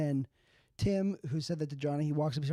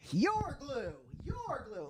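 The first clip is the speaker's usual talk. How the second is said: taunting; 9 semitones higher, 3 dB quieter, much slower than usual. Acted. Taunting horses.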